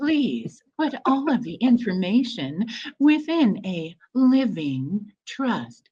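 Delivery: slow, drawn-out living trust; slowed-down elevator voice. Shown as a demonstration. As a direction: spell it out slowly for the kids in back. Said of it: "say all of that again slowly".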